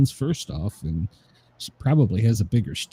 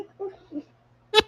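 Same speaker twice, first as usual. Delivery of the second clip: laughing through the words; 11 semitones higher than usual. Real, not acted. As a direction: laugh while you say it. Laughing at own anger.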